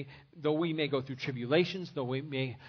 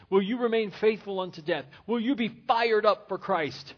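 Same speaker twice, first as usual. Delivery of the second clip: higher, louder pleading challenge; rousing preaching; 5 semitones higher, 5 dB louder than usual. Acted. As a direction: press the congregation hard. Closing altar call challenge.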